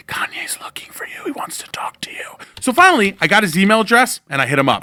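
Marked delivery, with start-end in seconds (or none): 0.1-2.4 unvoiced whisper from behind. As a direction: breathe it into his ear, secretive. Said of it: "whispered in my ear from behind".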